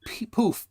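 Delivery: poof with a clear final f; correct poof. Shown as a demonstration. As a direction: lands the f sound in poof. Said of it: "not poop".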